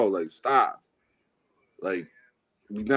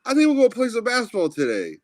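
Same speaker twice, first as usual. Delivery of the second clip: casual player voice; 8 semitones higher, faster than usual. Retold or imitated. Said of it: "and said".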